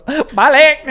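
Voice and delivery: loud, high mock Jamaican outburst; hammed-up attempt at a Jamaican accent; bad Jamaican accent. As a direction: imitate a failed Jamaican accent, blurting it. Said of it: "Do a Jamaican accent".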